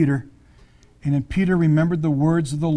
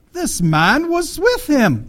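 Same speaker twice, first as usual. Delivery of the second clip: put-on high, drawn-out British movie accent; 9 semitones higher, much slower than usual. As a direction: accuse in a slow, theatrical British voice. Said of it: "You can always hear those British accents".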